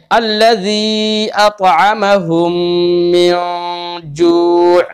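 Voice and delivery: मिन जू' with the न hummed through the nose, slow; nasal ghunna. Shown as a demonstration. Teacher reciting slowly to show ikhfa with ghunna. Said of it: "नाक में आवाज जा रही है".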